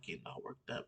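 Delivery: hushed whisper so she won't hear; whispered remark about the sister in the next room; whispered aside. Real, not acted. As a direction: drop to a whisper, keep it secret. Sister nearby in bathroom.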